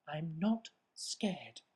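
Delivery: not scared. Acted. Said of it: "said the Gruffalo's child".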